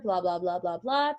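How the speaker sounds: usual speaking voice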